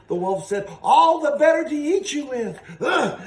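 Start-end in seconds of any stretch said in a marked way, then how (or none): 0.8-2.6 higher and louder hammed-up wolf growl; big bad wolf. Wolf to Little Red, a hammed-up menacing roar. 2.8-3.2 mock scream. Little Red screams.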